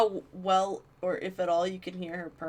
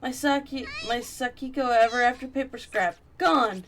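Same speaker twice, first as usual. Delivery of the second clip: loud, higher shock at the loss; alarmed cry; 5 semitones higher, 6 dB louder than usual. Acted. Mayu finding her charm gone, loud alarmed cry. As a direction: cry out in dismay.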